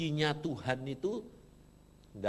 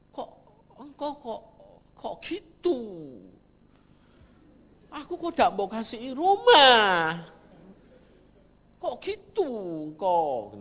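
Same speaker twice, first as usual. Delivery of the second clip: high, pleading whine to God; tearful complaint; 9 semitones higher, 10 dB louder than usual. Retold or imitated. Preacher voicing his tearful complaint to God, high and pleading. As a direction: protest like a hurt child crying. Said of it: "Nangis aku".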